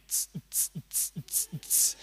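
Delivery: unvoiced tss-tss of distant music; party beat hiss. Imitating the sound of the party.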